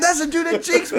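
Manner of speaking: high, loud and laughing. Retold or imitated. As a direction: blurt it through laughter. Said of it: "I said".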